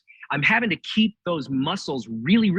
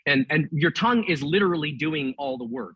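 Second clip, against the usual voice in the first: normal talking voice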